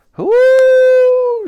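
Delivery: loud, very high, long hoot; shouted mammoth hoot. A narrator's reading. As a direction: hoot in triumph. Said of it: "shouted Wooley".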